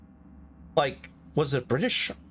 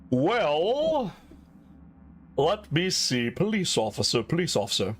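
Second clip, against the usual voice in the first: camp Southern. Shown as a demonstration. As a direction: try on a put-on Southern police officer accent. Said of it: "I was putting it on hard earlier".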